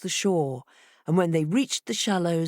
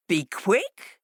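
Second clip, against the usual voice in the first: mocking fox. A narrator's reading. Fox turning on the foolish fishes, scornful. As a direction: echo it with disdain.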